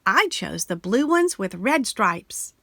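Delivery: plain reading voice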